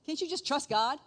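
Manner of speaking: normal talking voice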